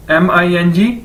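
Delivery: normal talking voice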